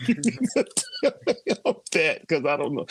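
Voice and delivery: giggling through the advice; laughing. Real, not acted. Dad laughing while advising his daughter. Daughter told to tell boyfriend.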